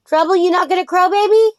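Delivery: high, sing-song coaxing to a pet rooster; doting pet talk. Real, not acted.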